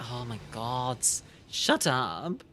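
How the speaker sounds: usual speaking voice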